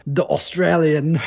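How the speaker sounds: slow, drawn-out mock Australian accent; joking half-hearted Australian accent; bad Australian accent. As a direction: put on a clumsy Australian accent for the surfer. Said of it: "that's a terrible accent".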